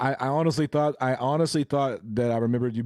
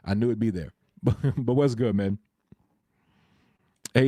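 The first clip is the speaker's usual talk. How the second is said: ordinary talk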